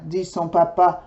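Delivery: plain reading voice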